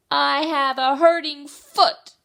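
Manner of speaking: high, full-voiced yell; shouting from behind. A narrator's reading. Child a long way behind Mommy, shouting. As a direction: holler it to someone far ahead. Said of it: "so I had to shout".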